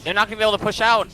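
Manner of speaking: fast, loud live casting; excited play-by-play. Real, not acted. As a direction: call the final push live.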